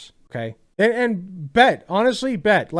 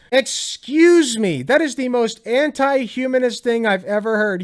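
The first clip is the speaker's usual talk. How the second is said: higher and louder, slow indignant "excuse me"; outraged disbelief; 6 semitones higher, 5 dB louder than usual. Real, not acted. Streamer reacting to the angel's line, indignant outburst. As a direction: recoil in shocked offense.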